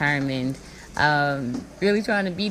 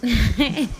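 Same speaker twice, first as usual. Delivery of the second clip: laughing; 8 semitones higher, 5 dB louder than usual. Real, not acted.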